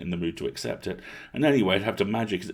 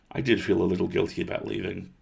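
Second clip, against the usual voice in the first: usual speaking voice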